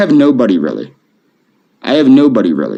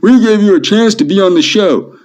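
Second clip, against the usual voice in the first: high-pitched imitation of their complaint; mock indignant quote; 11 semitones higher than usual. Retelling what the show's people told him, mock indignant. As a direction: mimic them in a raised, put-on voice. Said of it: "they were like".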